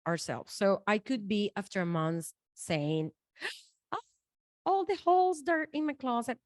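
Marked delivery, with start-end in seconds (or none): 3.4-3.9 mock gasp. Acting out shock at closet. 3.9-6.4 mock shocked outrage. Mock outrage about the holes.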